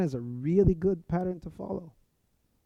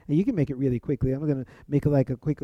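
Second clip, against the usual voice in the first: ordinary talk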